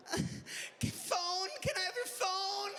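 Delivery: put-on high, slow shouted kid plea; mock child begging a parent for a phone; begging toddler.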